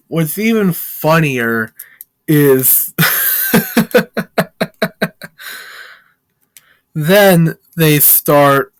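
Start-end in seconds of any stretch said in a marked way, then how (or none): 3.0-5.6 high burst of real laughter. Real, not acted. Narrator cracking up at the funny part, laughing for real. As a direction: break into a genuine laugh.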